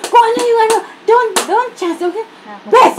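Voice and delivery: a hammed-up shouted scolding in English; mock yell. Maid imitating her angry employer, mock yell. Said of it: "Sumigaw uli, sabi niya".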